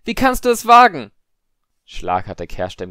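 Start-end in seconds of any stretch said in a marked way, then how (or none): 0.0-1.1 very high, very loud outrage; outraged scream. A narrator's reading. Padma confronting Harry in the common room, screaming. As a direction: shriek it in furious indignation. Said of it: "schrie Padma".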